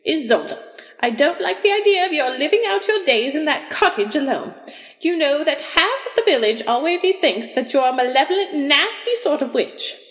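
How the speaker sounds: high-pitched, fretting mother's voice; disapproving mother. A narrator's reading. Mother objects to her daughter living alone, disapproving. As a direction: scold high, as a worried mother.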